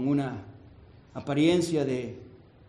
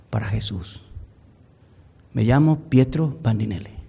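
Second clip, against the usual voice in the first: anguished confession; 5 semitones lower, 7 dB louder than usual. Acted. Weeping man's confession, acted.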